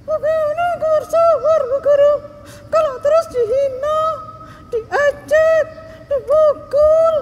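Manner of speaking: put-on high, loud childlike protest; a hurt little girl crying out against the teacher; upset child shouting.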